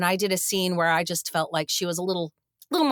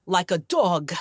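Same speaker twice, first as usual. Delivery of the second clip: lightly imitated storyteller landing the punchline; Chris Walken; 4 dB louder than usual. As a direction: deliver it with a hint of his cadence. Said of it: "I do a terrible Chris Walken".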